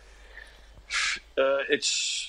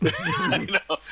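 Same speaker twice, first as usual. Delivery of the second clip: laughing through the words; a real laugh breaks out on it. Real, not acted. Laughs at the deep question.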